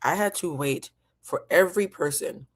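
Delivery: usual speaking voice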